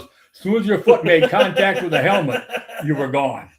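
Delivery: real laughter through the words; can barely keep from laughing as you say it; laughing punchline. Real, not acted. Umpire delivers punchline, laughing.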